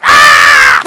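low, loud startled shout; panicked yell. Retold or imitated. Pastor reenacts his yell while jumping in to save the girl. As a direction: blurt a panicked yell as you leap. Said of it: "I was like".

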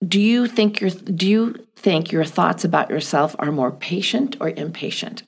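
usual speaking voice

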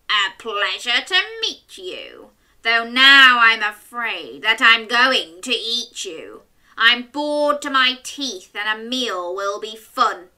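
loud, hungry storybook wolf; big bad wolf. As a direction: boom it out like a greedy wolf.

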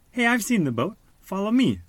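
high, bright, eager call. A narrator's reading. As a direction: cheerfully lead the way. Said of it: "she said".

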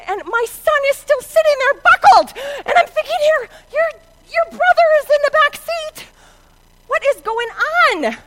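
high, loud frantic exasperation; hammed-up mother's disbelief; panicked outrage. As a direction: retell it in rising alarm.

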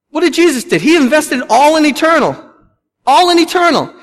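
high, loud emphatic preaching; preaching climax. Acted. Pastor urging the church to invest in eternity, preaching climax. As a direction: lift it to a climax.

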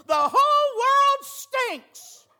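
very high, very loud and very slow, hammed-up despair; exasperated. Preacher acting grandpa giving up, very slow exasperated hammed-up wail. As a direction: throw your hands up and wail it. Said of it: "threw his hands up in exasperation and said".

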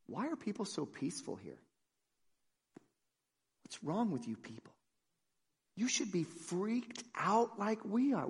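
very soft, puzzled near-murmur; hushed bewilderment. Acted. Freaked-out visitor wondering at peaceful churchgoers, hushed and baffled. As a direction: say it under your breath, baffled by the calm.

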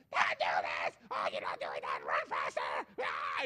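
harsh, loud mock yelling; yelling psycho parent. Parent berating her son at soccer practice, yelling. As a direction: yell roughly like an overwrought sideline parent. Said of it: "she's yelling at her son".

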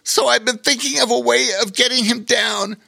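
high-pitched, apologetic otter voice; sad, apologetic otter. A narrator's reading. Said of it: "said a sad and apologetic Bobo Chico".

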